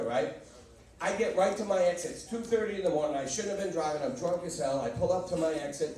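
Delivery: ordinary talk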